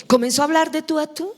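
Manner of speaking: ordinary delivery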